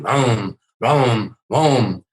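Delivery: loud mock engine roar, vroom vroom; imitated revving engine. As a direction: rev it up like a car engine, loud. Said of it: "Biff across the street racing his engine".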